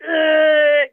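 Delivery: loud, high groan of pain; retelling a contraction with a real groan. Retold or imitated. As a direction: cry out through a contraction. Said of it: "I'd be like".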